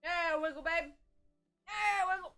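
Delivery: high, whooping encouragement; cheering on. Pretend. Egging [name] on to wiggle, high excited cheer.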